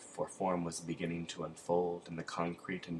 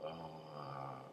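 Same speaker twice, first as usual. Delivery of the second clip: hoarse croaked ah, ooh, oh, fading softer; croaking; 4 dB quieter, much slower than usual. A narrator's reading. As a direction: croak the sounds out like a frozen prisoner, dying away.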